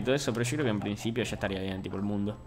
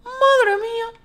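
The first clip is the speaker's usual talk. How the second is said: much higher falsetto madre mía; falsetto cry; 9 dB louder, much slower than usual. Acted. Streamer squeals in mock shock at a mishap.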